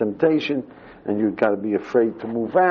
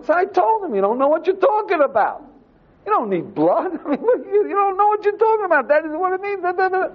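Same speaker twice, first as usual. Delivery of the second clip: much higher, bursting with glee; gleeful boasting. Retold or imitated. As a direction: crow it out, thrilled and high-pitched. Jim's bold claim reenacted, laughing.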